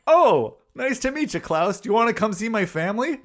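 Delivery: put-on high, loud, dopey kid greeting; dumb kid voice. Retelling the movie, dopey Iggy greets disguised Santa, high loud and eager. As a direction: play a clueless, overeager boy. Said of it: "Iggy's just this dumb piece of shit".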